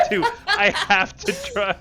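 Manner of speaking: laughing attempt. Real, not acted. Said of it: "need to do that accent".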